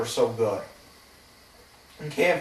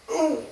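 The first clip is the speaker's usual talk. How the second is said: high laughing ooh; 14 semitones higher than usual. Real, not acted. Laughs recalling.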